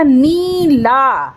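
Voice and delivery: "नीला" slowly, both vowels held long; "नीला" in two long syllables; stretched vowels. Shown as a demonstration. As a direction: pull out "नी", then a big open "ला". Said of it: "ना को खींच के बोला और ला को बड़ा सा बोला".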